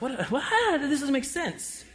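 put-on a kid cringing at his dad; embarrassed teen. Son embarrassed by his dad, high soft baffled voice.

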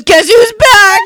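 high, loud mock bellow; rowdy announcement. Acting out Ron storming downstairs to his friends, rowdy announcement. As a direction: announce it big to the guys, imitating him.

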